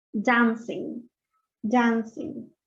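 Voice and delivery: "dancing" with an a said through a wide mouth, between a and e; open a in dancing. Shown as a demonstration. Teacher models dancing for students. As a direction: say "dancing" slowly with the ae vowel. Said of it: "voy a emitir el sonido A, pero con la boca en forma de E".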